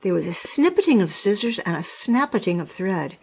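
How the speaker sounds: usual speaking voice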